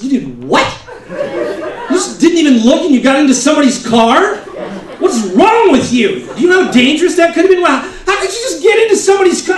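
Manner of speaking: high, fast, loud scolding; scolding wife. Retold or imitated. Wife scolding her husband for getting into a stranger's car, high loud rant. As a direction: tear into him for his carelessness.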